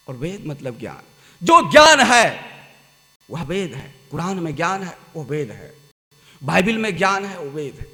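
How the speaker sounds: usual speaking voice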